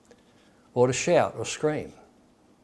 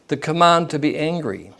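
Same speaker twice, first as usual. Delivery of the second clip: usual speaking voice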